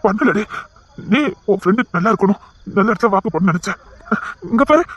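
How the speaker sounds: ordinary talk, nothing marked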